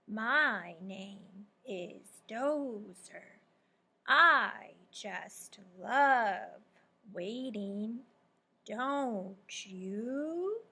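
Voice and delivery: very slow, drawn-out turtle voice; slow drawl. Acted. Teacher reading a turtle's lazy greeting, slow drawl. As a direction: stretch every word like a sleepy turtle. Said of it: "he said with a slow drawl".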